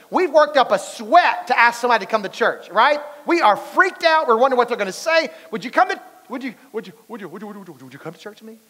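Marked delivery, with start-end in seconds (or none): 5.5-8.6 nervous stammer. Retold or imitated.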